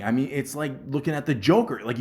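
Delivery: ordinary delivery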